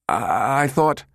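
faltering, rising in pitch; nervous stammer. A narrator's reading. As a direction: stumble over the opening words. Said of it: "Brinkerhoff stammered".

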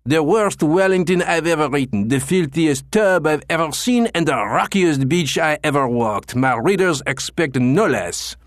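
booming indignation. Acted. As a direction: boom it through the whole gymnasium. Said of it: "his voice echoed through the gymnasium".

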